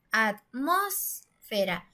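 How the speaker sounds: atmósfera in slow syllables, "mos" stretched and rising; stress on third-to-last syllable. Shown as a demonstration. Teacher splitting atmósfera into syllables, "mos" held and stressed. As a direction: lean slowly on the "mos" in atmósfera. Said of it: "suena más fuerte en la antepenúltima".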